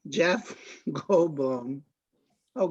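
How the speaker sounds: ordinary talk, nothing marked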